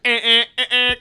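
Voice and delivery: loud, high imitated honks; mouth-made car horn; car horn honking. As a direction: honk like a car horn in short bursts. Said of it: "it's, like, honking at everybody".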